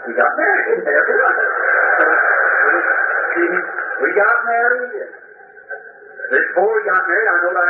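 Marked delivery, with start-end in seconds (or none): 1.0-4.0 a high, breathy laugh at his own story. Real, not acted. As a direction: laugh off the memory, high and airy.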